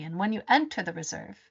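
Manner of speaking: usual speaking voice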